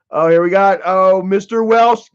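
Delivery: ordinary talk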